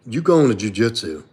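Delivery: ordinary delivery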